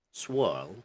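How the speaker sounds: "swirl" with hardly any R, "swuhl"; British "swirl", R swallowed into the vowel; minimized R. Shown as a demonstration. Teacher gives the example word, British "swirl" with minimized R. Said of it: "in British accent it's kind of minimized".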